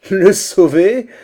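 very high, loud, incredulous question; savage, ringing derision; scornful exclamation. A narrator's reading. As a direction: throw it back in outraged disbelief. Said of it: "s'écria Sougriva en riant d'un air sauvage".

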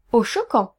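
plain reading voice

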